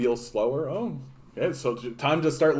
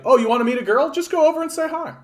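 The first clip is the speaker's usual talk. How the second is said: breezy handsome man; 12 semitones higher, faster than usual. Retold or imitated. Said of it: "the most beautiful man you've ever seen in the world saying".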